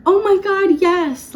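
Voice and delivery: high, loud gushing yes; the dream reaction, high and loud; movie-style excited yes. Retold or imitated. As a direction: squeal it like a movie proposal. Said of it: "like you see in the movies".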